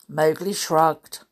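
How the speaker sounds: usual speaking voice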